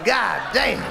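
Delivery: shouted disgusted cry; exclaiming. Acted. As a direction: yell it at the smell. Fish exclaiming at stench.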